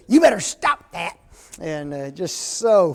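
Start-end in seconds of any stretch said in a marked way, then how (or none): 0.1-1.2 higher, louder scolding granny; a grandma snapping at a cheeky baby; imitating a mad grandmother's warning; mock angry grandma. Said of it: "that grandma got so mad, she said".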